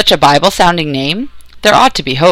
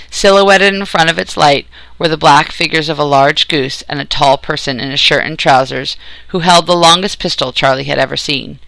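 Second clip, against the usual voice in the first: plain reading voice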